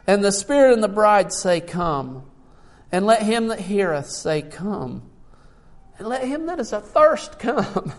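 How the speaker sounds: loud, rising proclamation of a Bible verse; ringing pulpit reading; preached scripture reading. Real, not acted. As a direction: declare the verse to the congregation, building to a high, loud peak. Reads scripture at full force.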